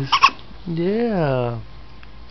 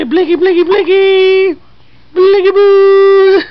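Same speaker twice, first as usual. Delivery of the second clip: put-on high, loud squealing baby chant; 14 semitones higher, 9 dB louder, slower than usual. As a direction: squeal it slowly, stretching boo.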